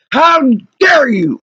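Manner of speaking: slow, drawn-out indignant cry; outraged. Real, not acted. Wronged customer repeating it to the garage workers, outraged. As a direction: stretch out the reproach in outrage.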